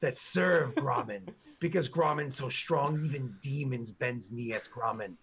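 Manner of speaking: slow broken-grammar orc boasting of his hero; proud orc guard. Acted. Orc guard proudly tells the party who Grommen is, slow boasting. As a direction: puff up and drag out each boast like a devoted grunt.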